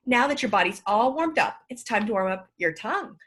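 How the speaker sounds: ordinary talk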